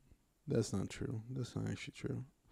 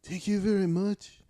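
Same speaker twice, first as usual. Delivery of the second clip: slow, higher, softer put-on Irish accent; Conor McGregor; 3 semitones higher, 4 dB quieter, slower than usual. Friend demonstrating the fighter's thanks, imitated Irish accent. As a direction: draw out a mock Irish lilt. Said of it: "it's like, "Connor".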